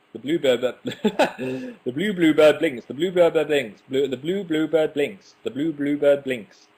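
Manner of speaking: tongue twister attempt. Shown as a demonstration. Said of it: "Say that five times fast".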